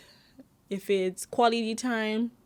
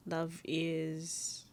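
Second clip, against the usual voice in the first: whispered; 9 dB quieter, slower than usual. Real, not acted. Soft heartfelt reflection.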